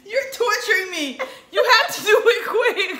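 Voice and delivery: half laughing, half begging; laughing in pain. Real, not acted.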